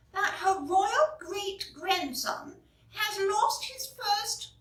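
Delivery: high, prim royal letter voice; reading the Queen's letter. Acted. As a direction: read it like a dainty royal note.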